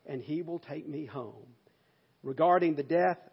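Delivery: ordinary talk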